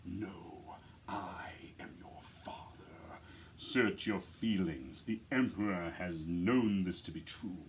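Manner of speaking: deep, slow James Earl Jones-style Vader with a big attitude; commanding dark-lord menace, low and deliberate; Darth Vader voice. Shown as a demonstration. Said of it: "and then I just lower my voice".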